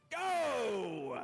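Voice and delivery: starting yell. Acted. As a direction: yell the start of the race to the whole arena. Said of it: "and he yells".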